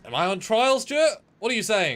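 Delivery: high, loud, put-on outrage; mock indignant protest. Accused co-host objecting to Stuart's question, mock indignant. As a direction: protest loudly as if put on trial.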